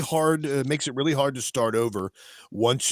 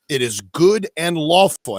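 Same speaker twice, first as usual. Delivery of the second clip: slow, louder, mock-solemn declaration; mock emphatic; 4 semitones higher, 8 dB louder, slower than usual. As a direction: drag it out like a pompous decree. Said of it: "with the appropriate accent".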